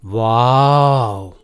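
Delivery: long, low drawn-out wow; awestruck wow. Acted. Smitten boy gaping in wonder, awestruck. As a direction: stretch it out slowly in admiration. लड़की को देख कर मुग्ध.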